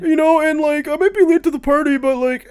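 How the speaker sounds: high, loud mock apology. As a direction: whine it like a latecomer. Imagined future self apology.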